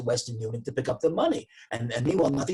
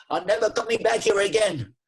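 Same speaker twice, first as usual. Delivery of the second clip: higher and louder, indignant; storming off; 10 semitones higher, 6 dB louder than usual. Retold or imitated. Customer storming out, indignant shout. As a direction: slam the door with your voice. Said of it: "And he storms out of there".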